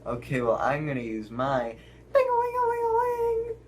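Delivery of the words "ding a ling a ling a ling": high sing-song ringing; phone ring imitation. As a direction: sing the ringtone fast and high. Phone ring sound effect.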